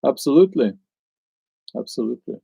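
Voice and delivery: ordinary delivery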